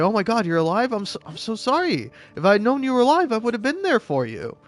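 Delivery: acted high-pitched, emotional gush; overjoyed apology. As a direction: pour out an apology to a long-lost child. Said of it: "real happy to see".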